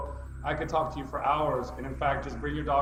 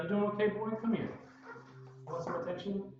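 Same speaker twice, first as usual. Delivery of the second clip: higher, soft, doting voice for a pet dog; talking to the dog; 4 semitones higher, 7 dB quieter than usual. Real, not acted. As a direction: coo to your dog to reassure him. Talking to his rescue dog.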